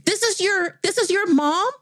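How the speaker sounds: very high-pitched, incredulous question; mock-shocked doctor; flabbergasted. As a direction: stammer it in acted disbelief, voice way up. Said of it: "he's having a heart attack".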